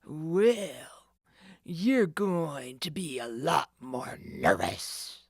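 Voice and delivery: low slow menacing growl; threatening. Acted. The thief warns Nancy, low slow threat. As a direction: threaten her slowly. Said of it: "the man said threateningly".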